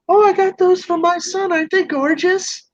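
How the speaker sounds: put-on high, proud old-lady voice; sweet old lady. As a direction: show off the plants innocently. Old lady answers the cop.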